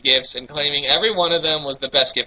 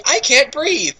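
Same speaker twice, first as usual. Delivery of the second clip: mock strangled plea; 9 semitones higher, much slower than usual. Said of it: "trying to loosen his grip".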